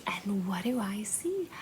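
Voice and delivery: soft breathy whisper of a secret; playful whisper. Pretend. Teacher whispering to the children during a band-aid game. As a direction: whisper it like a surprise to the kids. Storytime game, hushed reveal.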